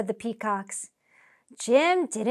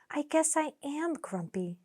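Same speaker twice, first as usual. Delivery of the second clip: sighed; 4 semitones higher, 7 dB quieter than usual. Acted. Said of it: "Jim sighed".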